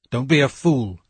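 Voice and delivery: plain reading voice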